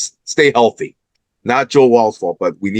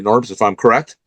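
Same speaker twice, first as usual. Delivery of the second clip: ordinary delivery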